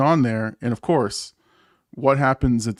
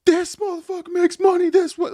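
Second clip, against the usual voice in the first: very high, loud heckler squawk; mock taunting; 5 dB louder than usual. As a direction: squawk it like a jeering passer-by. Voices scooter riders talking shit.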